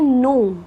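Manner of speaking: नो with a silent k, said like no. Shown as a demonstration. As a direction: say नो without the k sound. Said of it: "के letter है वो silent होता है". (के silent, सिर्फ़ नो)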